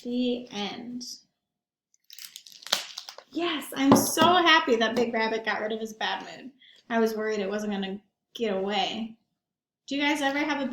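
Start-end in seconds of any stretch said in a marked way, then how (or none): none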